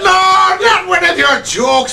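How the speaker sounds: loud protest. Real, not acted. Cohost dreads a bad joke.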